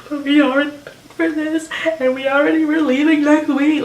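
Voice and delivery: sobbing out the worry in a high voice; panicked crying. Retold or imitated. Crying patient panics to the doctor about the paid cruise, high and tearful. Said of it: "I was freaking out".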